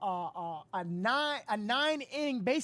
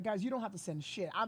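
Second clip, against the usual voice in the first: ordinary delivery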